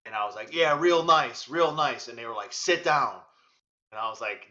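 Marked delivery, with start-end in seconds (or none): 0.5-2.1 loud, higher mock praise; retold sarcasm, punched out loud; sarcastic retort. 2.5-3.2 louder imitated command; retold stern order. Retold order from airport staff.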